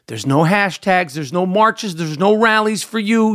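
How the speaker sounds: ordinary talk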